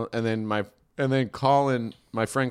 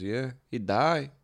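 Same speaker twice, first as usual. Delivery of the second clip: put-on slow, matter-of-fact Mexican-accented driver; 4 dB quieter than usual. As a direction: imitate the cabbie's Mexican accent, unhurried. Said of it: "the quintessential Mexican accent".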